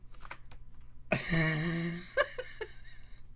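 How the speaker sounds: long, soft wheezing laugh; helpless laugh. Real, not acted. Streamer losing it before the new scene, helpless laugh. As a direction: crack up and run out of breath.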